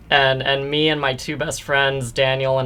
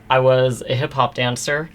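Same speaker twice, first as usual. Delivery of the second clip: ordinary talk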